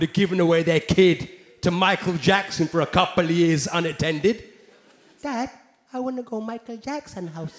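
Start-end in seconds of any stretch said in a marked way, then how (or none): 5.2-7.6 put-on soft, quiet little Indian kid pleading; small Indian boy asking his dad quietly. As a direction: ask Dad shyly in an Indian accent. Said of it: "Indian kid".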